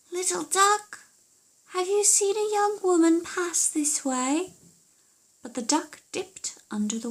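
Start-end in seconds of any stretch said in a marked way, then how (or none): 0.1-4.5 high, sly questioning voice; higher sing-song daughter voice; witch's daughter. Acted. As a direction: coax the answer out of the duck.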